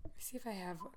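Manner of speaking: unvoiced aside while searching; whisper. Real, not acted. Daughter whispering while finding a voicemail. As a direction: whisper it to yourself. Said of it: "searching phone quietly".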